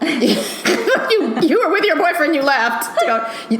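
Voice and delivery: usual speaking voice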